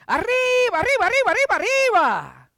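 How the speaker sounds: high, loud cartoon mouse cry of arriba; Speedy Gonzales. Preacher imitating a cartoon mouse for the congregation, high and loud. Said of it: "That's how Speedy would go".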